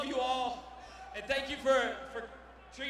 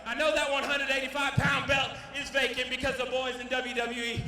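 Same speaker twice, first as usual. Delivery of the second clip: ordinary talk, nothing marked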